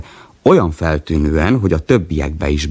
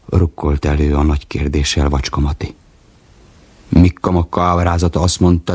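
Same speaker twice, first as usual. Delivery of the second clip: usual speaking voice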